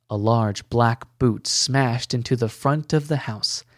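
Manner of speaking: plain reading voice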